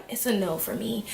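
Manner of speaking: soft, deflated mock sadness; sad contestant. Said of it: "they come out the room sad".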